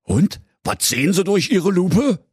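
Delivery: high, eager royal question; curious. A narrator's reading. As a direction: ask with curiosity, pitched well up. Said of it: "fragte König Pilsner neugierig".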